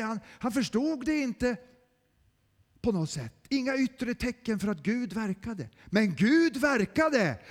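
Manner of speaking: loud, rising preaching; forceful preaching. Real, not acted. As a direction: preach it with mounting fervor. Preaching rises to full force.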